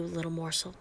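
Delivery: fond hushed pet name; low, soft endearment. Acted.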